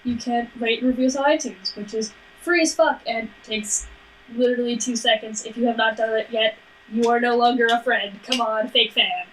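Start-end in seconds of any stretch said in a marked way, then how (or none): none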